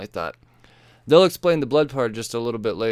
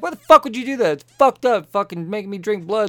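furious yelling; 7 semitones higher, 5 dB louder than usual. Retold or imitated. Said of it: "She's like".

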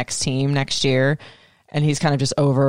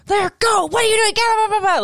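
sideline yelling. Retold or imitated. Mom on the sidelines yells at her son on the field. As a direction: bark it like a pushy soccer mom.

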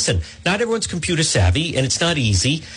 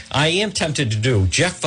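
ordinary talk, nothing marked

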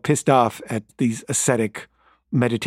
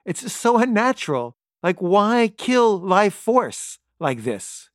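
indignant; 9 semitones higher than usual. Retold or imitated. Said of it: "I was like".

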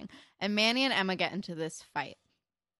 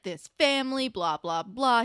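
mock yelling; 4 semitones higher, 6 dB louder than usual. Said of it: "he's like".

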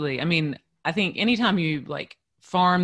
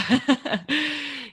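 short laugh; 11 semitones higher than usual. Real, not acted. Laugh after answer.

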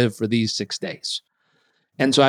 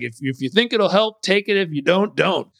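higher mock imitation of dad; 6 semitones higher than usual. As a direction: parrot the advice back, mocking. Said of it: "it was basically like".

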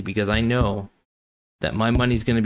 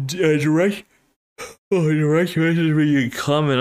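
words stretched through a real yawn; 6 semitones higher, 4 dB louder than usual. Real, not acted. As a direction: fight a yawn while talking. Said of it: "I'm sorry, I keep yawning".

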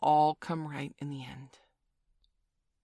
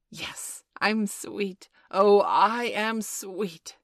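building to a loud, drawn-out crow; gleeful boasting; 4 semitones higher, 8 dB louder, much slower than usual. A narrator's reading. Peter forgets his manners after flying, boasting gleefully. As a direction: show off, louder and slower as you go. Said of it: "forgetting his manners again".

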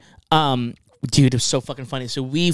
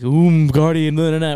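hammed-up eerie boss voice, louder; mock spooky boss; 5 dB louder than usual. Gamer spoofing the Witch Queen's taunt, mock spooky. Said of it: "stupid spooky dialogue".

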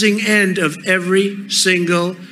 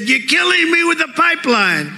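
Putin's complaint; 7 semitones higher, faster than usual. Retold or imitated. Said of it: "He said".

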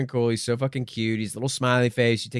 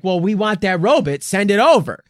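mock demand; 6 semitones higher, 5 dB louder than usual. Demanding robot on the phone.

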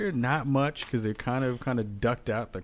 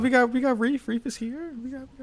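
excited; 13 semitones higher, much faster than usual. Real, not acted. Excitedly announcing Reef.

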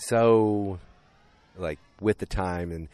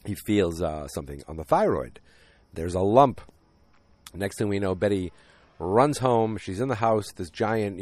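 normal talking voice

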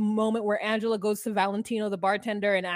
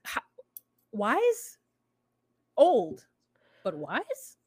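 incredulous mock; much slower than usual. Mocking Kim's claim to wisdom.

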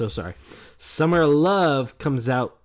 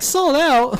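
mock announcement; 13 semitones higher, 4 dB louder than usual. Acting out a streaming service sold out.

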